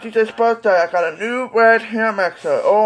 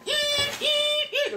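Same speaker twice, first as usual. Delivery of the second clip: very high, thin sung beeps; smoke detector beep; 7 dB quieter, much slower than usual. Retold or imitated. Toy's button plays the smoke detector, high beeping. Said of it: "Yep, the smoke detector sound".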